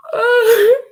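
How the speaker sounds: a high, crying laugh through tears; tearful high laugh. Real, not acted. Mother embarrassed at crying, tearful laugh. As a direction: laugh it off while still crying.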